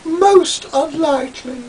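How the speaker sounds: put-on high, snooty girlish voice; snooty girlish Julian. Julian snubbing Toad, high and snooty like a girl. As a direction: toss it off with your nose in the air. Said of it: "said Julian, who sounded just like a girl".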